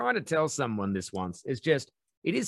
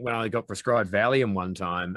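ordinary talk

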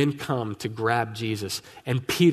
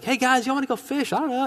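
much higher, shrugging casual ask; offhand suggestion; 11 semitones higher than usual. Retold or imitated. Peter casually asking the disciples to go fishing, offhand. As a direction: toss it out like it's nothing.

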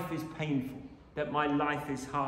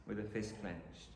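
"With a fist clenched" dropped much softer and lower; quiet echo; 6 semitones lower, 12 dB quieter than usual. Shown as a demonstration. Preacher letting the image sink in, hushed repeat.

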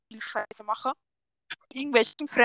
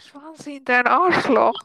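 louder, slower, voiced out loud; 6 dB louder, slower than usual. Real, not acted. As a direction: finally say it out loud. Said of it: "Sag laut".

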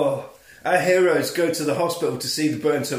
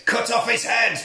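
high, loud gleeful command; villain order; 8 semitones higher, 4 dB louder than usual. Retold or imitated. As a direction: bark the order with relish. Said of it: "He says".

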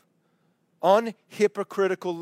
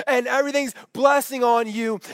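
usual speaking voice

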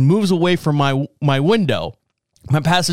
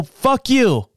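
higher, punchy "Fuck you"; defiant curse; 7 semitones higher than usual. Retold or imitated. Driver to the man at the window, higher defiant curse. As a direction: spit it at him, finger up. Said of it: "And I go".

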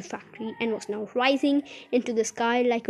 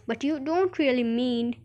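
usual speaking voice

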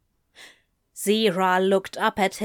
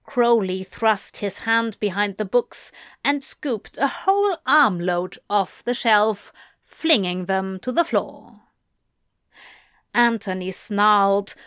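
ordinary delivery, nothing marked

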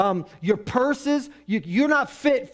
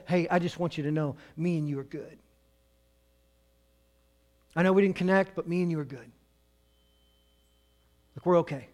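tender note; 3 semitones lower, 4 dB quieter than usual. Retold or imitated. Love note on her pillow.

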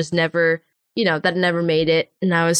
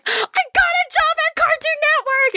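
high, thrilled announcement; announcing her new job to the crowd at the con, ecstatic; ecstatic shout. Retold or imitated. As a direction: burst out with the news. Retelling her joyful announcement.

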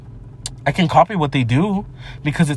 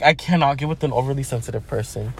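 usual speaking voice

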